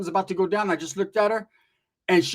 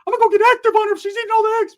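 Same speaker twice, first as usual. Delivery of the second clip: very high, loud and fast; Ricky fired up about the eggs; hyped Ricky; 6 dB louder, faster than usual. Retold or imitated. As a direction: rant in a squeaky rush. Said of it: "Ricky's like".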